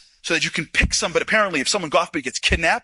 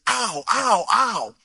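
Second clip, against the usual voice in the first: pained ow; 5 semitones higher, much slower than usual. Retold or imitated. Soldier turns his ankle.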